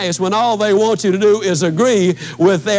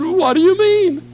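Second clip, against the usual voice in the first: higher, startled woman's voice; shocked woman; 8 semitones higher than usual. Retold or imitated. Woman stunned by his blunt prediction, startled high asking. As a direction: voice her jolted disbelief.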